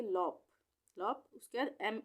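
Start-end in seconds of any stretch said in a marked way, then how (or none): 0.0-0.4 लॉप with an open 'aw' vowel; correct reading. Shown as a demonstration. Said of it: "इसको पढ़ेंगे लॉप". (लॉप, खुले 'ऑ' स्वर के साथ)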